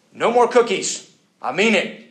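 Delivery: loud, high-pitched warning; stern parent. Acted. Parent warns children after dinner, stern and loud. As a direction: lay down the law to the kids.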